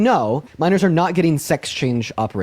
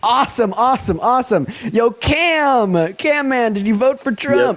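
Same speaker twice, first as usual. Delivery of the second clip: raised-pitch showman patter; hype announcer; 7 semitones higher, slower than usual. Acted. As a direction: play it up for the live audience. Host hypes the next voter.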